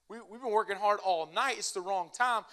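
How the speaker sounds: exasperated we know fishing; indignant fishermen. Retold or imitated. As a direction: push back like tired pros who know their trade. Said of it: "this is their business".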